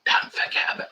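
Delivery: unvoiced whisper, trying not to be noticed; mimicked sneaky whisper. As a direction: breathe it out without voice. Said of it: "just say it in proper voice".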